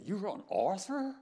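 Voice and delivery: mock delighted gasp of a question; excited surprise. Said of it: "she just got so excited".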